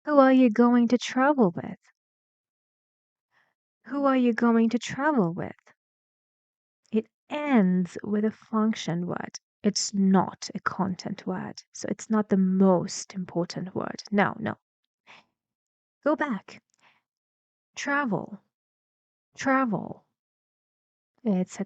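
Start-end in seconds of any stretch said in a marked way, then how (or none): 0.1-5.6 teacher's model question, pitch dropping after "travel"; falling intonation. Shown as a demonstration. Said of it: "we need falling intonation". 17.7-20.0 "Travel" high on TRA, then falling; isolated "Travel" with a clear fall; first-syllable stress. Shown as a demonstration. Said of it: "what is the stressed syllable".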